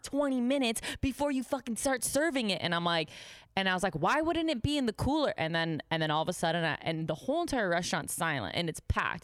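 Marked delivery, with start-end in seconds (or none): 0.0-2.6 high, exasperated imitation; berating boss. Boss berating waitress over the keg, imitated anger. 3.9-5.3 indignant retort. Retold or imitated. Retelling her retort to boss.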